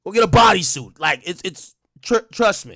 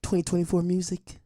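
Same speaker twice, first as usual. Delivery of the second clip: put-on baby voice; 4 dB quieter than usual. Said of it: "It's my baby voice".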